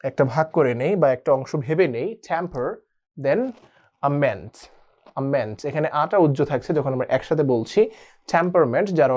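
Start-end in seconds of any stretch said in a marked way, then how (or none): none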